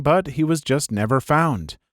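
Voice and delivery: usual speaking voice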